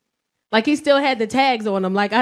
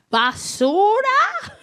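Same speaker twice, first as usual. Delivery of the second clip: high, slow, stretched rising question; drawn-out, high incredulous toddler question; mock child's question; 9 semitones higher, much slower than usual. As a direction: imitate a child pointedly asking, voice climbing. Child points at passing son.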